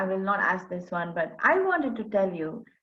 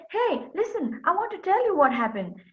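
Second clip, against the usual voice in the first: urgent plea; 8 semitones higher than usual. Acted. Girl pleading to explain.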